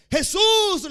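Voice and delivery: a high, loud cry of a name; shouted plea. Retold or imitated. Blind Bartimeo shouting for Jesus from the roadside. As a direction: cry out his name over the crowd. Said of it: "le decía".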